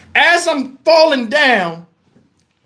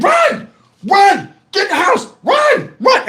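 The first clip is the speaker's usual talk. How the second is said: high-pitched warning yell; acted urgent warning; 10 semitones higher, slower than usual. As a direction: yell at the kids to flee. Yells warning to his kids.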